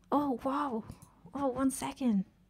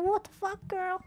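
much higher, shocked yelp; a startled high cry at the screen; startled outburst; 10 semitones higher, slower than usual. Real, not acted. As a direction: caught off guard as the character falls. Sudden fall in game.

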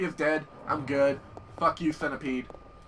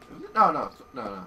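normal talking voice

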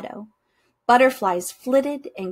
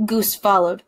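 ordinary delivery

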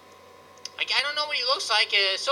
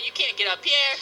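ordinary delivery

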